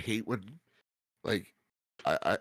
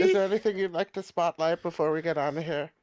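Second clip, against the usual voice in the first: higher sing-song pretend interview; puppy baby talk; 6 semitones higher, 3 dB louder than usual. Host jokingly interviewing his puppy, high baby talk. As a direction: ask the dog like a tiny guest.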